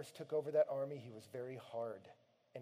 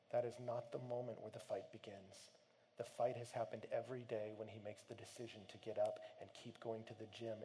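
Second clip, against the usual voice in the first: ordinary talk, nothing marked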